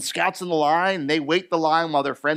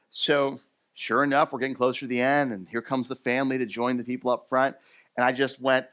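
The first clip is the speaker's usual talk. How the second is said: usual speaking voice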